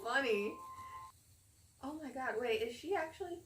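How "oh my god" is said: ordinary delivery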